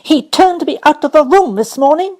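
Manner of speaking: put-on high, loud indignant girl; worked-up child; excited complaint. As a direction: stamp your foot and protest. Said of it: "stamping her foot in excitement".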